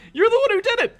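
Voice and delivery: squeaky high sing-song accusation; high taunting. Acted. Taunting the player, high sing-song accuser. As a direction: point the finger in a high mocking voice.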